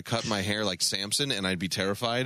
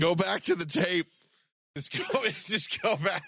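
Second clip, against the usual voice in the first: playful shout; 6 semitones higher than usual. Real, not acted. Mock argument over the word.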